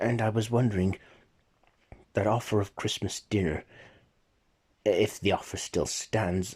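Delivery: usual speaking voice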